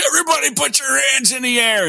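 high shouted holdup order; mock robber shout. Street tough bursting into the diner, yelling at everyone in a high shout. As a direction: yell it like a street tough robbing the place.

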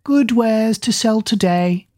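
high, slow hawker's call; old peddler woman calling. Acted. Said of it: "called out".

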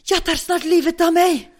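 high, loud, drawn-out outburst; agitated neighbour. Retold or imitated. Neighbour to tenant at her door, agitated shouting. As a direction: wail it out loudly, imitating the neighbour.

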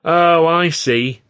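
loud, slow 'so that's it'; indignant realisation. A narrator's reading. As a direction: draw it out loudly, accusingly. Performer reacts indignantly to agents' arrival.

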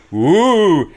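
loud, high "woo"; imitated scare noise. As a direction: swoop up high like a monster jumping out. Imitating monsters scaring kids at door.

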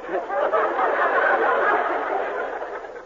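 high breathless giggling laugh; giddy laughing fit on the phone. Acted. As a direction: break into a long silly giggle.

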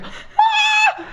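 high squealed mock shriek. Trisha caught out in the car, a high mock shriek. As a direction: let out a high embarrassed shriek. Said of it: "She was like".

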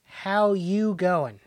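slow Australian "How you going?" with the vowels drawn out; slow Australian greeting. Shown as a demonstration. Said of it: "how's it going?".

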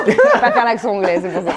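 laughing through the words; amused admission, breaking into laughter. Real, not acted. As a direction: laugh at your own failed attempt. Laughing at own failed accent.

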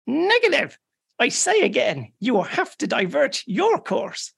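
high, insistent radio refusal; firm refusal again. Acted. Irish officer refuses a second time, high and insistent. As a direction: hold your ground as the Irish officer.

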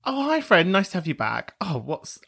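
normal talking voice

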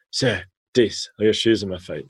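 "dis" with d for th, lightly voiced kid; a light imitation of the boy saying "dis" proudly. Teacher imitates the boy showing off his shoes, lightly. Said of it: "he couldn't pronounce the T H".

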